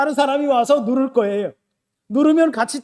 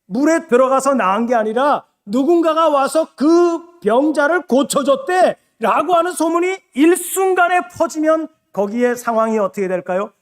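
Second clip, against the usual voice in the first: usual speaking voice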